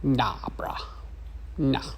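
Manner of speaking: whispered, unbothered "nah, bruh"; dismissive whisper. Retold or imitated. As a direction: brush him off under her breath. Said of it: "she's gonna be all like".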